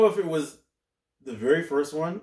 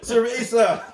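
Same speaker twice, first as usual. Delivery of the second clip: mock Spanish accent; 8 semitones higher, 5 dB louder than usual. Mock Spanish words, laughing.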